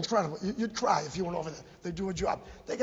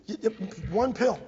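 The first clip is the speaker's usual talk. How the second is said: normal talking voice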